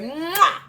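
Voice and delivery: high, exaggerated "mwah"; playful smooch noise. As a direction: blow a big audible kiss. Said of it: "And I said".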